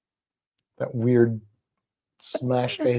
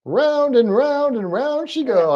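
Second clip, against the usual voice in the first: higher, slow sing-song; 9 semitones higher, slower than usual. Pretend. Owner narrating the lizard spinning, slow and sing-song. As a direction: croon it as she circles.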